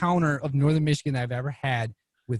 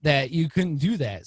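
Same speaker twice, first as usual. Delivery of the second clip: usual speaking voice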